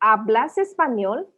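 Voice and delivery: hablas said slowly with no H, ah-blahs español; silent h, slow. Shown as a demonstration. Teacher repeats hablas español slowly for learners. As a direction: glide through hablas español, no breath at the start. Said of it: "hablas without the H".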